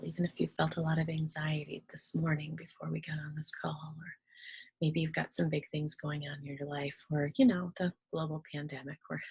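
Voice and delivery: calm meditation guide with long pauses; guided meditation. Real, not acted. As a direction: ease them into relaxation, leaving room to breathe.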